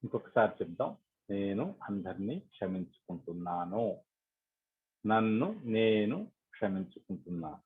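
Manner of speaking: soft, measured forgiveness affirmations; guided meditation affirmation. Acted. Leader guiding meditators to forgive all and themselves, softly meditative. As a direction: recite softly, with a lift on the opening words.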